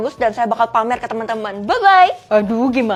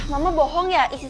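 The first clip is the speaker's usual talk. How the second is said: ordinary delivery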